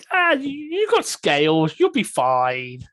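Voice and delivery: high, loud little weasel. Acted. Weasel reassuring a scaly companion, high-pitched voice. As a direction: pitch it way up as a small animal. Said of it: "your weasel said".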